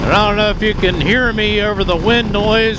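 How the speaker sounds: half-yelling into the mic at speed; shouting over wind. Real, not acted. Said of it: "I'm almost yelling into the mic".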